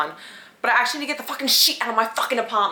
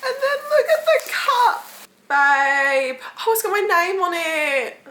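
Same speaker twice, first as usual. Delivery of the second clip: high, loud, tearful squeal; overwhelmed joy; 10 semitones higher, 5 dB louder, slower than usual. Real, not acted. Bridesmaid-to-be finding her named cup, overwhelmed. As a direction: wail with delight, near laughing and crying.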